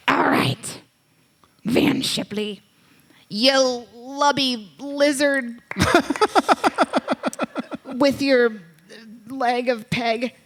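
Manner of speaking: slow, hammed-up pirate voice; badly faked pirate talk; bad pirate accent. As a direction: announce the winner like a clumsy pirate. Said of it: "I am so terrible".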